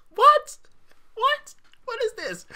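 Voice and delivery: very high squeaks of disbelief; mock incredulous. Host reacting to the archbishop's response, squeaky incredulity. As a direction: squeal each question in comic bafflement, easing off in volume.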